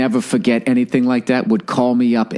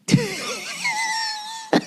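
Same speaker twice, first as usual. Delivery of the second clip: real laugh; 7 dB quieter than usual. Real, not acted. Comic laughs at his own story.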